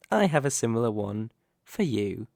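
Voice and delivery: ordinary delivery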